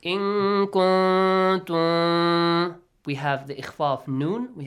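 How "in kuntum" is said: loud, very slow chant of "In kuntum" with long nasal hums on each n and the m; hidden sound. Shown as a demonstration. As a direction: hide the noons and final meem of "In kuntum" in sustained nasal tones. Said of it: "You hide the sound".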